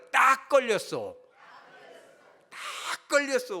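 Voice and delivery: high, slow singsong caught you; drawn-out playful taunt; mock triumph, caught red-handed; teasing gotcha.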